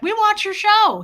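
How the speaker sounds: high, loud retold exclamation; excited mimicry. Officer's excited remark retold.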